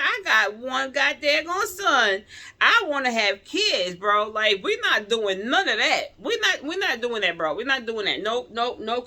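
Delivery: loud, high, furious no; indignant loud refusal; retold angry refusal. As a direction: shout down the doctor's idea. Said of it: "I got mad. I was pissed".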